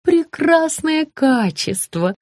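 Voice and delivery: high, slow sugary praise; gushing praise. A narrator's reading. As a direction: praise it sweetly and slowly. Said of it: "похвалила Рита".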